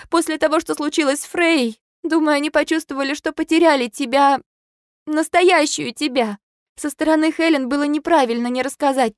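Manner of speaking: Lucy trying to explain Helen and Aiden; pleading friend. A narrator's reading. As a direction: plead for understanding. Lucy pleading, much higher.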